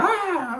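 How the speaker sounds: very high yelp of "Ah!"; retold frightened yelp. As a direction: yelp as if the bee just landed. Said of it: "I flipped out".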